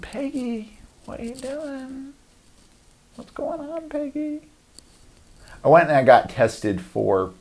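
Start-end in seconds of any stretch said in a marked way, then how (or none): 0.0-4.4 much higher and softer, puzzled questioning; bewildered neighbor. Retold or imitated. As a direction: ask Peggy what she's doing, baffled. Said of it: "you gotta wonder, like".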